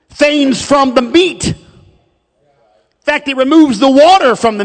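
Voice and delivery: loud scripture recitation with weighty emphasis; preacher driving home each phrase; emphatic preaching. Real, not acted. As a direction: declare the verse to the congregation.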